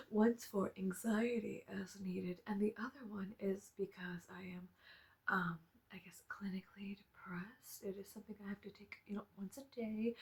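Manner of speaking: soft, polite public voice that sinks to a whisper; prim and proper, hushing the embarrassing part. Retold or imitated.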